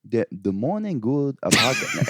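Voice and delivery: slow, halting mock Chinese accent. Shown as a demonstration. Trying a Chinese accent for friends, slow. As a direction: drag out a bad Chinese accent. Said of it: "the Chinese one's, like, a little more like".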